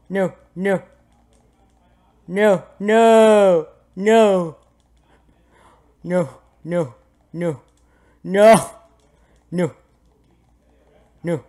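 high, repeated yelps of refusal; mock protest. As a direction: yelp each refusal in playful resistance. Keeps resisting the pull.

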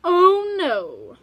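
loud high oh no; loud high alarmed mother. Acted. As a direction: cry out in alarm. Mother sees the money.

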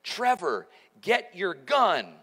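wife yelling at husband to grab his gun, urgent; retold yell. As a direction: call out to wake him, urgent. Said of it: "she yells".

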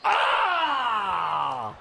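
long falling "aah" scream; startled yell. Real, not acted. Gamer reacting to a close play, yelling. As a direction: cry out, sliding down in pitch.

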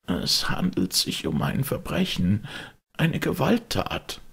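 high, quavering fearful servant; trembling voice. A narrator's reading. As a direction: shake with dread as you say it. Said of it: "mit bebender Stimme".

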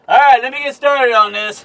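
usual speaking voice

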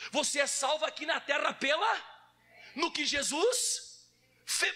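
high, emphatic preacher's delivery; forceful preaching. Real, not acted. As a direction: drive the point home to the congregation. (pregação enfática)

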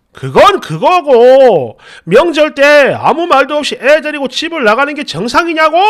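mock indignant husband shouting; imitating angry retort. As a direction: drag out the angry protest.

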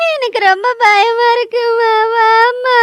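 crying out to mother, terrified; desperate tearful call for mother; sobbing plea. Acted. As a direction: weep the words and beg her to come. Crying, calling mother after blood.

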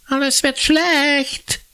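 mock the prophecy like a bratty kid; crowing little brother. A narrator's reading. Little brother through the door, crowing. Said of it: "kräht Jakob".

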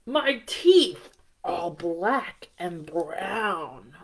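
low, slow disgusted delivery; slow yucky poem line; grossed-out reading. Acted. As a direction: make it sound gross for kids.

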